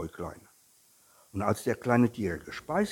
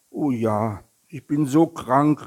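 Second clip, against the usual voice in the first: louder, raised-pitch complaint; calling out; 5 semitones higher, 10 dB louder than usual. A narrator's reading. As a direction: cry out how ill you are, storybook style. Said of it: "rief der kleine Tiger". (klagend gerufen)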